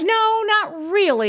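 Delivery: high, slow, breezy denial; mock nonchalant. Answering the host, high breezy fake calm. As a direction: brush it off in a high drawl. Mock-casual reply to freaking out.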